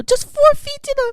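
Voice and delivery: high, loud disbelief at the shallowness; astonished squeal. Real, not acted. To listeners, amazed that treasure lay so shallow, squealing. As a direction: shriek it in amazement.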